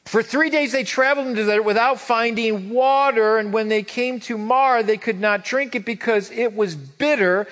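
loud, high, driving sermon voice; preaching at full force. Acted. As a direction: preach it to the back row. Preaching the Marah passage.

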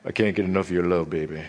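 deep, soft sweet-talk to a lover. Acted. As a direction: drop way down and murmur it like a seductive soul singer.

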